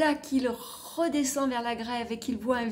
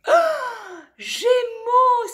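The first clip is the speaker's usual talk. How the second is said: much higher, louder cry of joy; excited exclamation; 13 semitones higher, 10 dB louder, much slower than usual. Acted. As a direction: shout with wonder. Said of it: "s'exclame Sospire".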